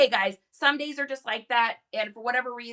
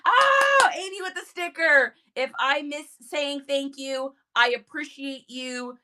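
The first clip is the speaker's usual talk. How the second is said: ordinary delivery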